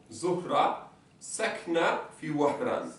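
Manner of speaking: Zohra sakna fi Wahran said slowly and evenly; slow model sentence. Shown as a demonstration. Teacher repeating the sentence slowly for dictation. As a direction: read it out slowly for the class. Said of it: "Elle habite à Oran".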